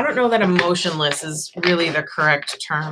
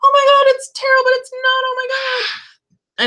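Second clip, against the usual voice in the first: much higher, loud squealing gush, slowing at the end; gushing fan; 4 dB louder than usual. Retold or imitated. Mocking excited fans of the Wildwood deck, squealing gush. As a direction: squeal like a thrilled newcomer. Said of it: "that one was like".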